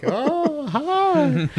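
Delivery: squeaky high little hello; high mock greeting. Joking about forest eyes, tiny high hello.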